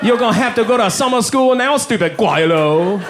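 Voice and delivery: mock Chinese accent. Chinese classmate taunting about summer school, mock Chinese accent. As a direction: gloat in an exaggerated Chinese accent. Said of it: "doing that voice".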